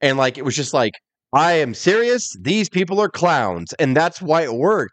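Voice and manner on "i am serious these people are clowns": slow, deliberate straight-man announcer; measured, emphatic mock gravity; Gene Okerlund. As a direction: imitate a dead-serious interviewer, word by word. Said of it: "beauty about Gene Okerlund".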